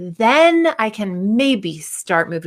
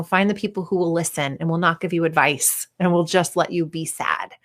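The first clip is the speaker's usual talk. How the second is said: usual speaking voice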